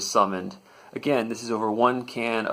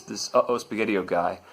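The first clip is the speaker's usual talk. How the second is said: ordinary delivery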